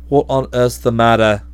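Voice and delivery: testily. A narrator's reading. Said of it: "he said testily".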